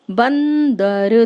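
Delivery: बंदर slowly, with the nasal ं held; nasal ang demo. Shown as a demonstration. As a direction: draw out the ं in बंदर. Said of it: "बा पे अंग की बिंदी".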